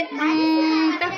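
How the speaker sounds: "अंता" slow, the n held in the nose; nasal ghunna. Shown as a demonstration. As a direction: hum the n of "अंता" through the nose. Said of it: "इसको हम थोड़ा नाक में ठहराएंगे".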